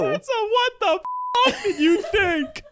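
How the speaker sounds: high, crying with laughter; laughing. Real, not acted. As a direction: laugh it out in disbelief. Laughing disgust.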